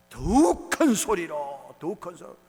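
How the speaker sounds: very drawn-out, loud call; booming, slow pulpit cry. Retold or imitated. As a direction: swell and hold each word loudly. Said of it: "더 큰 소리로 부르짖었다".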